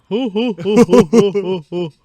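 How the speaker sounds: high, loud string of hoots; mock hooting. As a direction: hoot mockingly at a joke. Mock reaction to co-host's joke.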